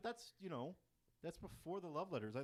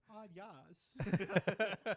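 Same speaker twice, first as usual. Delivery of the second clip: hammed-up Italian accent, loud; mock Italian accent; 6 semitones higher, 9 dB louder than usual. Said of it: "it's Italian".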